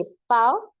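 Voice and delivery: पाँव as short "paao", no nasal; non-nasal first take. Shown as a demonstration. Urdu teacher contrasting for learners, oral vowel. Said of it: "फर्क समझे".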